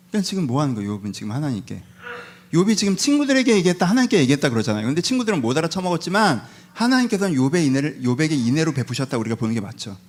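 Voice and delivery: usual speaking voice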